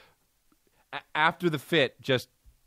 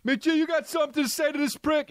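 imitating the angry actor; 12 semitones higher than usual. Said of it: "McG, dude".

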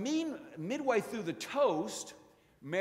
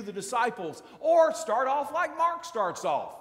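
louder, higher preacher's build toward a cry; rousing preaching; 4 semitones higher, 4 dB louder than usual. Acted. Preacher pressing his flock about the first sign, rising fervor. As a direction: drive the question home to the congregation.

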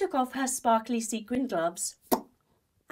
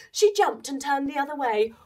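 plain reading voice

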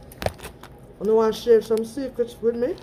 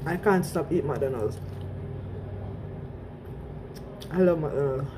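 straw touching lips; speaking over a drink; talking between sips; 4 semitones lower, 6 dB quieter than usual. Real, not acted. Seen 1: Shake cup held up, straw at her lips while speaking.

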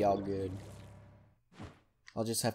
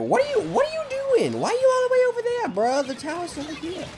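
exasperated squeal. Real, not acted. Player asking teammate why he's over there, high exasperated squeal.

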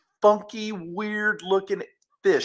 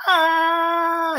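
high, drawn-out aah; long wail of someone flung through the air; acted scream; 10 semitones higher, 5 dB louder than usual. Storyteller flinging his hero into space, acted scream.

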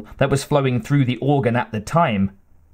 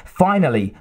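ordinary delivery

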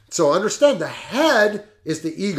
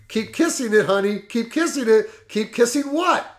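the attorney puzzling it over, pitched higher, rising on what; lightly voiced suspicious lawyer, half-hearted; a touch of worry building to a baffled question; mock worried repeat; 7 semitones higher than usual. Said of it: "he must have become worried".

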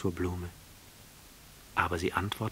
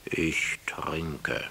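low, whispered, gloomy answer; gloomy drinker; 3 semitones lower than usual. A narrator's reading. The drunkard answering the prince, gloomy and whispered. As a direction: mutter it darkly. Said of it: "mit düsterer Miene".

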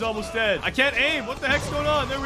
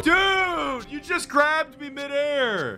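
high, drawn-out protest yell; outraged disbelief; 6 semitones higher, much slower than usual. Real, not acted. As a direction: cry out at being snatched. Grabbed in midair by opponent.

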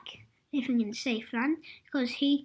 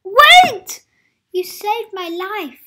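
shouting plea; 6 semitones higher, 12 dB louder than usual. Acted. Little Miss Safe begging Adventurous to stay, shouting. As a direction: call after him desperately. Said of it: "shouted little Miss Safe".

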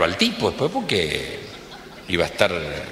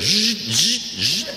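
higher buzzing motor noise; electric buzzing; 10 semitones higher, much slower than usual. Acted. Imitating the car's electric seat, buzzing. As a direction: buzz like power seats moving.

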